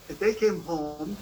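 normal talking voice

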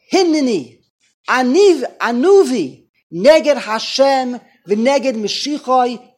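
wounded leader crying out to his people; impassioned tirade. Retold or imitated. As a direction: preach the leader's grievance at full force. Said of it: "into a tirade".

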